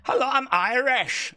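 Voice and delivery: light Irish accent demo. Shown as a demonstration. Streamer demonstrating an Irish accent for the text-to-speech, lightly Irish. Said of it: "Like that, isn't it?".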